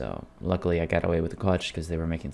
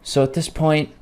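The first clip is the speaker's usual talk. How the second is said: frustrated; 4 semitones higher, 6 dB louder than usual. Real, not acted. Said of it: "I get really frustrated".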